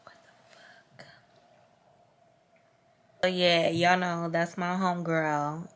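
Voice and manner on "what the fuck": barely audible shocked whisper. Real, not acted. Vlogger whispering in shock at something. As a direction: breathe it in disbelief.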